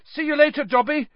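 high, hurried call; shouted. A narrator's reading. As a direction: call back while rushing off. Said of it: "Harry shouted".

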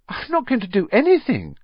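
put-on high-pitched boy's protest. A narrator's reading.

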